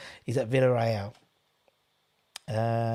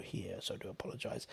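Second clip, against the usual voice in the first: a sudden unvoiced whispered aside; 7 semitones lower, 19 dB quieter than usual. Real, not acted. Streamer whispers an apology to a child viewer, cheeky whisper. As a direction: drop to a breathy secret whisper, cheeky.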